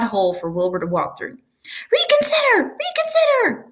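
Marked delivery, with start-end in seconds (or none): 1.9-3.7 much higher desperate goose cry; pleading goose cry. A narrator's reading. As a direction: beg him to reconsider. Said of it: "cried the goose".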